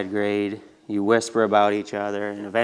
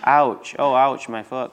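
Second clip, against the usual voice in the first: higher, louder yelp, then fading on the last words; cry of pain; 3 semitones higher, 5 dB louder than usual. Acted. Neil hurts his foot in the cart wheel, pained cry. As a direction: voice a boy who just twisted his foot. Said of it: "cried Neil".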